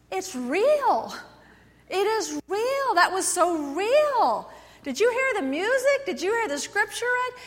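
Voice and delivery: high, loud, slow amazement; awed congregant. Pretend. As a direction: marvel that it all felt real. Imagined congregant after service.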